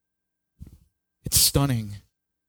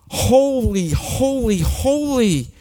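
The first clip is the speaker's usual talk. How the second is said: "Holy" called out much higher and louder, each word falling; awestruck angel cry; 9 semitones higher, 3 dB louder, much slower than usual. Acted. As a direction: cry "Holy" up high in overwhelmed awe, like a seraph. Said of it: "They're going".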